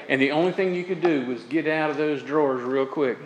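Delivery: ordinary talk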